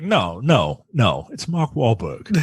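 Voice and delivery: usual speaking voice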